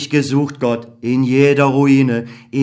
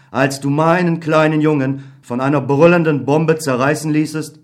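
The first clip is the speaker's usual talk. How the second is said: normal talking voice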